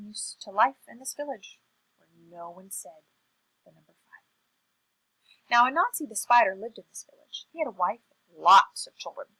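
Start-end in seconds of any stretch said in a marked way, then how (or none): none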